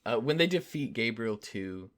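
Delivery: ordinary talk, nothing marked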